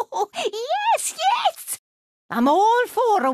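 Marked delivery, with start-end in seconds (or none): 0.1-1.8 high, gleeful squeal through laughter; wicked glee. Acted. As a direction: relish it in a much higher voice. Gleeful after cackling.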